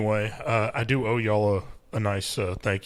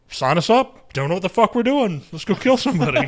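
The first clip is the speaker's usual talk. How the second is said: eager Canadian recruits; 7 semitones higher, 4 dB louder than usual. Retold or imitated.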